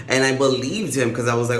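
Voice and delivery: ordinary delivery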